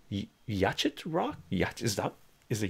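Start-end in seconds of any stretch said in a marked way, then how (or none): 0.5-1.4 "Yachet" sounded out as spelt, CH as in "chat"; unsure pronunciation. Shown as a demonstration. Streamer asking how yacht is said, "Yachet" sounded out as spelt. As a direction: say "Yachet" in two syllables, as a question. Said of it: "am I pronouncing this right?".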